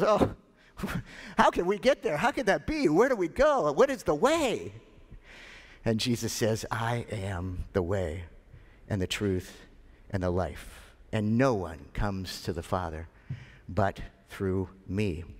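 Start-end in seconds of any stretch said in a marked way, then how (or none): 0.0-4.7 high, rapid-fire worried questions; pitched way up, fretting question after question; flustered doubter. Acted. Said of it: "the famous doubter". 6.7-15.2 unhurried, lowered voice, pausing between phrases; calm assurance. Acted. Jesus calmly answering the panicked doubter.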